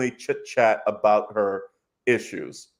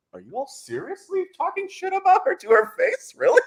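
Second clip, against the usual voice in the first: much higher, mock-shocked voice; incredulous bystander. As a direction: gape at the gossipers in disbelief. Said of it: "I'm just like".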